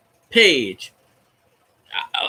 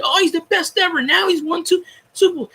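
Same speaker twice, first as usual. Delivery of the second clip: higher, sarcastic parody of gushing pundits; mocking fickle critics; 9 semitones higher than usual. Critics now praising Brees, mock gushing voice. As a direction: ham up the critics' fake praise.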